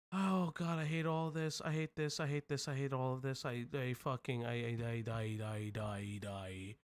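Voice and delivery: trailing off into a defeated mumble; fading despair. Real, not acted.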